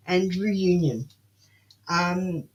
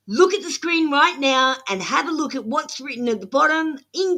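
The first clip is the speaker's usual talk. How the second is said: exasperated scolding; 5 semitones higher than usual. Real, not acted. Tarot reader scolding viewers who ignore instructions, fed up and higher-pitched. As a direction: scold the whole chat into reading the screen. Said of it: "now we're getting serious Aussie attitude".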